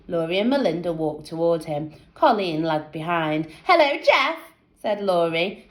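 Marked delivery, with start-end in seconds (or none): 3.6-4.4 put-on girl voice. A narrator's reading. Girls greeting Jeff.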